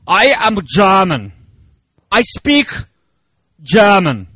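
slow, hammed-up German accent, "German" said as "Jerman"; mock German accent. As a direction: put on a jokey German accent for the congregation. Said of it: "I'm just doing an accent thing".

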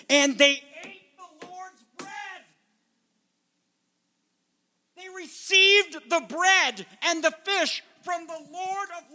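high, slow, grieving preacher's cry; preaching lament. Acted. Preacher mourning the lost crowd, high and slow. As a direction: let it rise and ache, slowly.

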